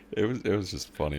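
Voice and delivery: usual speaking voice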